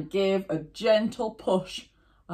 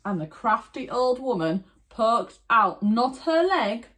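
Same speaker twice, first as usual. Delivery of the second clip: ordinary delivery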